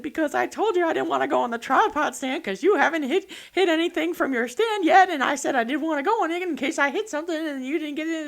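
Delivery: high, loud, rushed excuse; nervous breathless justifying; rushed anxious pleading. Retold or imitated. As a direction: blurt out the worry fast. Anxious explanation to father-in-law.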